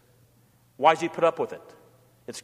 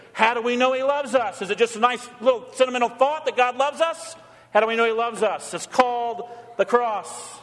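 fervent sermon climax, higher pitched; preaching at full force; 6 semitones higher than usual. Acted. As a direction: preach it to the young people, lifted high. Preaching climax to the eight confirmands.

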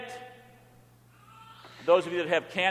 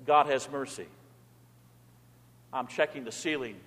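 ordinary delivery

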